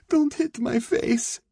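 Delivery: very high, slow, frightened plea; drawn-out, terrified begging; pleading. A narrator's reading. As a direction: beg in a high, desperate voice. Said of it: "she pleaded".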